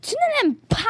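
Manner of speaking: loud, very high-pitched disbelief; startled badger fishing for approval; dismayed question. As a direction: ask it squeakily high and taken aback. Badger anxiously questions rabbit's opinion.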